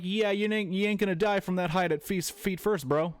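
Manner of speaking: scoffing disbelief. Retold or imitated. Mocking the jumper's feet-first leap.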